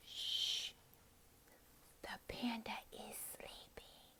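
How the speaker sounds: slow, very soft whisper; hushed whisper. Shown as a demonstration. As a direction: whisper so as not to wake someone. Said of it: "and you're like".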